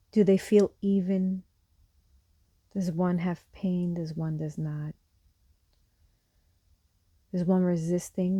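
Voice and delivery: calm, unhurried guide with long pauses; guided meditation. Real, not acted. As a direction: guide them gently through a body scan, softening as each phrase ends. Leads a seated meditation.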